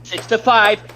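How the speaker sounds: high, hyped sports caster call; play-by-play commentary. Pretend. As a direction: call the match like a live announcer. Mock esports play-by-play.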